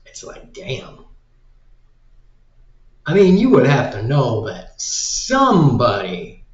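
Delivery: normal talking voice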